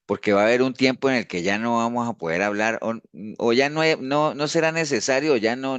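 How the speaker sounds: normal talking voice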